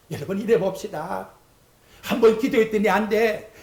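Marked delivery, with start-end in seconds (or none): none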